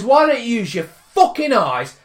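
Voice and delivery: loud, high, exasperated demand; retold shout back. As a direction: fire it back in a roadside row. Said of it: "so I shouts back".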